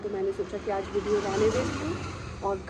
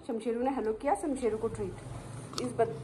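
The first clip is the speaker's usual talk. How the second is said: normal talking voice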